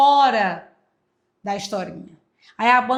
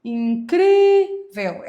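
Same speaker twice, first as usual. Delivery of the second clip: Incrível slowly, CRÍ loud, high and stretched; stressed syllable demo; 7 semitones higher, 7 dB louder, much slower than usual. Shown as a demonstration. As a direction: hammer and hold the CRÍ of Incrível. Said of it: "ela é paroxítono". (Incrível com CRÍ forte, agudo e alongado)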